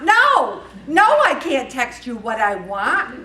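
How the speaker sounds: indignant parent, loud and emphatic; exasperated refusal. Retold or imitated. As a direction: burst out with a mock-outraged no, then slow down. Snapping back at daughter's text request.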